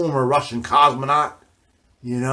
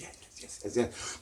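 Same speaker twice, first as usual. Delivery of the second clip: eager whisper; 15 dB quieter than usual. Retold or imitated. Eager to buy.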